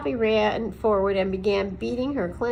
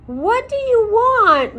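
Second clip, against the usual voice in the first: high, loud, slow demand; angry demand; 13 semitones higher, 11 dB louder, slower than usual. A narrator's reading. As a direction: demand it, furious owl. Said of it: "Mister Okax demanded".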